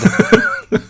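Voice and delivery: high wild cackling; wild rambling cackles. Real, not acted. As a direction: cackle it out helplessly. Wild laughter.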